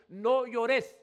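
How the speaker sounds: loud, high quoted angel; quoted angel, no llores. Retold or imitated. Preacher voicing the angel telling John not to weep, loud. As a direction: call it out like the angel stopping his tears.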